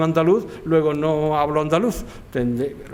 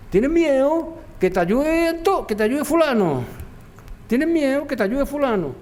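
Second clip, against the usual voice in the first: put-on Málaga accent; 9 semitones higher than usual. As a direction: imitate a local from El Palo calling to Antonio. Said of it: "oí que uno decía". (imitando el habla malagueña de El Palo, aguda y lenta)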